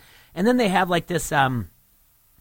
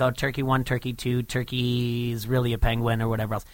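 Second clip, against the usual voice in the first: ordinary delivery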